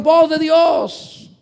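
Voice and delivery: very slow, loud, high cry of Voz de Dios; crowd shouting. Retold or imitated. The crowd shouting praise at Herod, stretched and booming. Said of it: "le gritaron".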